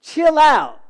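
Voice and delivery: high, loud, mock exasperation; hammed-up chill out. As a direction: bark it like the wife would. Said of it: "as my wife tells me".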